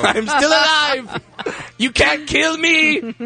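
high, drawn-out triumphant taunt; mock defiant cry. Survivor joking about the bus, mock defiant boast. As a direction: crow it slowly, way up high.

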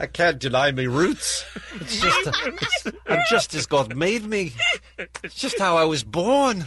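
hammed-up British accent, upswing on each last word; put-on British accent. Co-host defending his new accent to teasing colleagues, put-on British accent. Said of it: "What's with the upswing of every last word?".

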